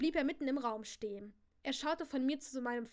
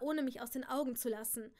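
ordinary delivery, nothing marked

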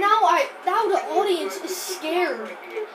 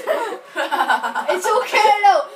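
laughing relief; 6 dB louder, much slower than usual. Real, not acted. Player whose iPad fell, laughing it off. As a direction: laugh it out, drawn slow.

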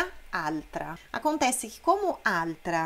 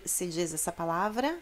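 ordinary talk

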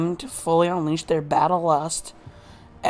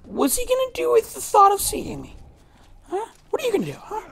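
squeaky mocking taunt, much higher. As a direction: taunt in a high cartoon voice. Taunting enemy, high voice.

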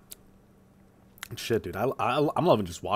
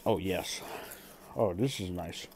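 whispering; 2 semitones lower, 7 dB quieter, slower than usual. Real, not acted. Eating while lying like otter.

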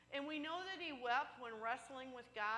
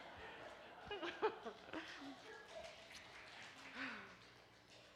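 laughter; 3 semitones higher, 4 dB quieter than usual. Real, not acted. Laughs at own joke.

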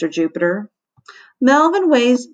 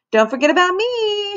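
put-on high girlish call; 13 semitones higher than usual. A narrator's reading. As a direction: chirp up to be noticed. Girl voiced high.

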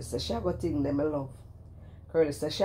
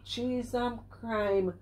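drawn-out exasperation; 7 semitones higher, much slower than usual. Real, not acted.

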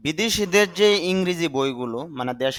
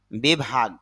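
normal talking voice

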